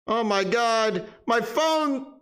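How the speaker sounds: high, whiny mock-terrified wailing; mock panic. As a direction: wail as if the phone is eating your fingers. Phone jump-scare panic.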